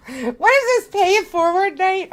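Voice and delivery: delighted disbelief at the flood of gifts; amazed. Real, not acted. More gifts keep arriving.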